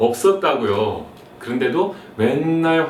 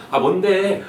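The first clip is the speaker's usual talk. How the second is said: irritated son; 5 semitones higher, 4 dB louder, slower than usual. Acted. Son's reply in skit.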